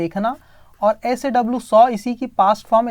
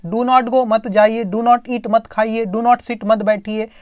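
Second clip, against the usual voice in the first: usual speaking voice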